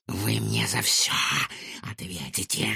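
soft, threatening hiss; menacing hiss. A narrator's reading. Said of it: "грозно прошипела Матильда".

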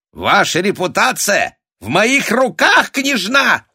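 very high, loud menacing squeal; shrill, furious intimidation; threatening shriek. A narrator's reading. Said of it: "угрожающе взвизгнул".

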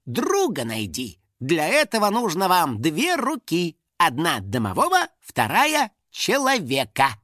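slow, measured riddle recital; reading out verse. A narrator's reading. House spirit reading an ancient inscription, solemn recital. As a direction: declaim the rhyme slowly, with weight. Finik reads out ancient rhyme.

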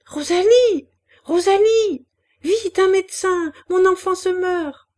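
high, loud call for help; frantic shouting. A narrator's reading. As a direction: shout for the maid in desperate urgency. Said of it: "elle cria". (cri d'appel affolé)

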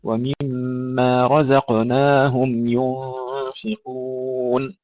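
slow chant of رزقناهم with clear ز and measured long alef; tajweed demonstration. Shown as a demonstration. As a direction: chant slowly, voicing the ز in رزقناهم. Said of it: "ومد الألف حركتين". (ترتيل تعليمي بطيء لـ«رزقناهم» بزاي واضحة)

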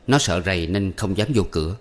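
usual speaking voice

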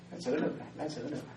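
whispered aside, almost to himself; hushed repeat. Acted. Monk repeating his point to himself, hushed. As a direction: drop to a breathy murmur.

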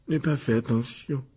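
solemn, sulky ghost; ton grave. Acted. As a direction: answer gravely. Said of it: "dit Mimi d'un ton grave". (d'un ton grave)

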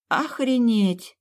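slow, high, stunned exclamation; dumbfounded, drawn-out reaction. A narrator's reading. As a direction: stretch the word out in disbelief. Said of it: "всё, что смогла выдавить из себя".

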